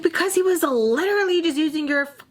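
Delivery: high, indignant outburst; retold exasperated scolding. Retold scolding of her friend.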